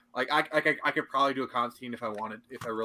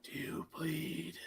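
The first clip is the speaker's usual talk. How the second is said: menacing whisper; 6 dB quieter, much slower than usual. Acted. Doing a famous line, threatening an enemy, menacing whisper. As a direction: threaten in a hushed, drawn-out whisper. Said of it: "I gotta do this line".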